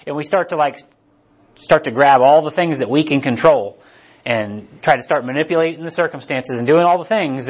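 ordinary talk

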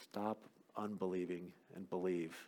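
very soft, low, intimate command; hushed appeal. Shown as a demonstration. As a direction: bring it right down to a low hush. Same command repeated, hushed.

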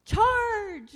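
loud, high charge cry; shouted charge. Shown as a demonstration. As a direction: yell it like a cheering fan. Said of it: "you get in there and".

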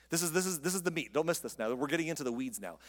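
ordinary talk

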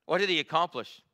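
ordinary talk, nothing marked

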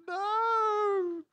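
high, loud cry of no; a sharp high shocked no; shouted no. Retold or imitated. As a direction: yell it out in shock. Audience reacts to movie ending.

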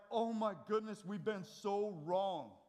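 loud, high and slow, like people weeping over their wrongs; grieving cry. Retold or imitated. Preacher voicing the grieving people of Jerusalem, loud slow lament. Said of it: "They're like".

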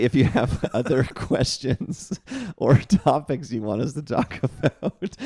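real laughter breaking up the words; laughing while talking. Real, not acted. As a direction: get it out slowly through giggles. Cracking up during the sign-off.

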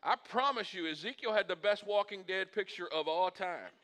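normal talking voice

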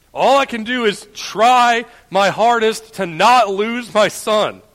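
high, loud, slow sermon delivery; impassioned preaching. Real, not acted. Preacher assuring friends God never loses his children, impassioned preaching. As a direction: proclaim it with full conviction, stretching key words.

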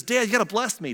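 high, insistent plea to Dad; demanding son. Acted. Cheated son demands a blessing.

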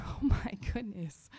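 slow, whispered astonishment; retold whispered shock; hushed realisation. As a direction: breathe it out slowly, as it dawns. Dawning realisation over her Bible.

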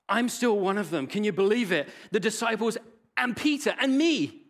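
high, loud, delighted; joyful relief. Acted. Peter hearing he is still a disciple, high joyful outburst. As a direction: burst out with joy.

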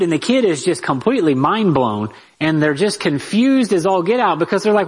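normal talking voice

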